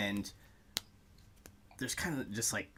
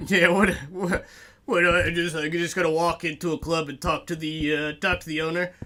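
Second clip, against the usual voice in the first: bugbear bouncer; 4 semitones higher, 5 dB louder than usual. Acted. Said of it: "And Marcy's like".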